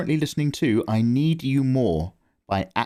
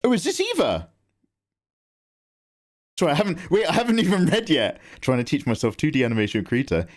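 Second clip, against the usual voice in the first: usual speaking voice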